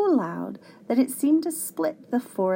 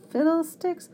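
raised-pitch cranky grumble; mild, higher-voiced exasperation; grumbling; 8 semitones higher than usual. A narrator's reading. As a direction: grumble it as a fussy professor, voice pitched up. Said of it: "the professor grumbled".

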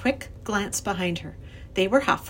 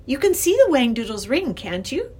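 put-on higher, louder girl's challenge; crying out; 5 semitones higher, 5 dB louder than usual. A narrator's reading. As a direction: cry out insistently. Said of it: "she cried".

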